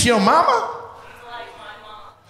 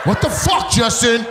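higher shouted what the fuck; mock Don's outrage; 4 semitones higher than usual. Comic voicing Don at Justin, higher shocked shout. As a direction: protest in shocked disbelief. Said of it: "Don's like".

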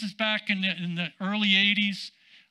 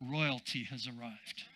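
much lower and much softer, a grand hushed announcement; T L Osborne impression; 8 semitones lower, 12 dB quieter than usual. Preacher tells the congregation how Osborne talked, in a low hushed grand voice. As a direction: intone it low and hushed like a grand old evangelist. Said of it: "That's how he talked".

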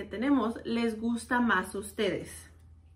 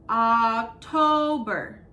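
October even slower, loud; slow model word; 5 dB louder, much slower than usual. Shown as a demonstration. Teacher repeats October very slowly for kids. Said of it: "models month slowly".

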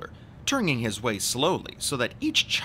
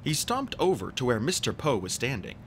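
ordinary delivery

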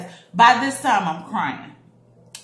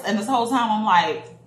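ordinary talk, nothing marked

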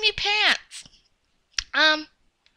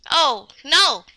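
ordinary talk, nothing marked

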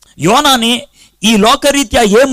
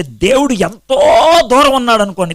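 usual speaking voice

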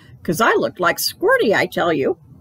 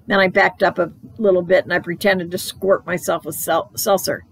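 usual speaking voice

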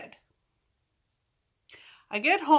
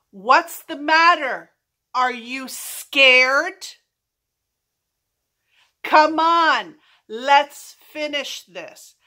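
loud, drawn-out mock challenge; retold taunting shout; 3 semitones higher, 6 dB louder, slower than usual. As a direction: call after them, daring them to fight. Taunting muggers while chasing them.

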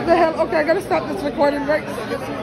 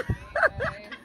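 squealing high-pitched laugh; high giggle; 5 dB quieter than usual. Real, not acted. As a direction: burst into a delighted high laugh. Laughing at kids greeting.

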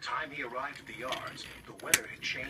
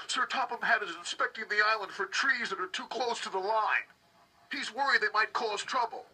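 quarrel; 6 semitones higher, 4 dB louder than usual. Acted. Engines quarrel over the accident.

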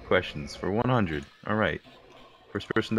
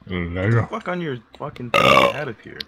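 yelling; 3 semitones higher, 8 dB louder than usual. Real, not acted. Shouting at friend in game.

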